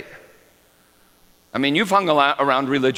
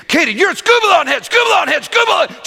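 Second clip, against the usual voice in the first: high, loud, fast playground shout; child yelling insult; 8 dB louder, faster than usual. Retold or imitated. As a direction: scream it like an angry little girl. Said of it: "She started yelling at Katie".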